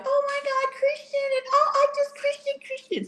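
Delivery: put-on high, gushing teen crush; swooning girls. Mother mocks girls gushing over her son, high and swooning. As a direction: gush like a smitten cheerleader. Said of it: "they were like".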